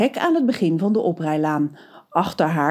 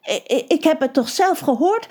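stammering; 8 semitones higher than usual. A narrator's reading. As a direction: stammer the first word, high and upset. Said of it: "begint ervan te stamelen".

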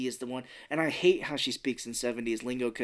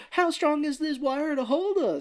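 very high, fretful question; hammed-up alarm in a high voice; mock worry; 3 dB louder than usual. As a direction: imitate a nervous character's squeaky doubt. Retells Gribble doubting the wire.

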